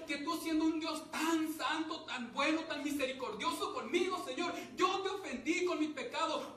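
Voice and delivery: prayer that starts normal and swells into a loud plea; preacher's prayer rising from earnest to loud pleading; pleading prayer building up. Acted. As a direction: beg the Lord for forgiveness, climbing to loud. Voices a repentant prayer.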